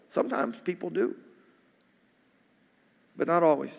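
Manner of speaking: ordinary talk, nothing marked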